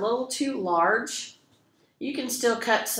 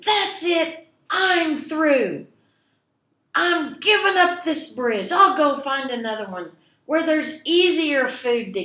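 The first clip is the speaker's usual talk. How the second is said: high, loud defeated troll voice; fed-up troll; 7 semitones higher, 7 dB louder than usual. Acted. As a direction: quit in a loud huff like a soaked troll. Soaked troll gives up.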